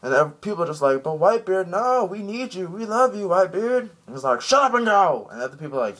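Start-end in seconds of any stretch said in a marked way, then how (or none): 1.0-3.9 high, pleading mock crewmen. Crewmen begging Whitebeard to stay, high plea. 4.4-5.3 high, louder mock bark of an order; gruff order. Whitebeard ordering his crew to leave, loud mock shout.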